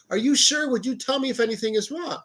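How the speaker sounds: high imitation of a fretting grandmother; worried grandma. Grandma doubts grandson is fine, high worried imitation. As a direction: press him gently in a high grandma voice.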